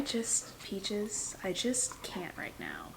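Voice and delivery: whispered, worn out plea to the dog; exasperated whisper. Real, not acted. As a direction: breathe it out, out of patience. Pleading with chewing dog.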